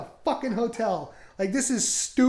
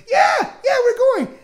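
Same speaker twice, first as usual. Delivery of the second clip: shouted high and loud, over-eager; 10 dB louder than usual. Retold or imitated. Brother eagerly agrees to give stranger a ride, loud shout. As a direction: blurt it out like a clueless yes-man.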